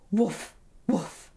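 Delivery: lightly imitated short woofs; dog woof. A narrator's reading. Dog answers Edward from behind the wall, woofing. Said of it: "woofed the dog".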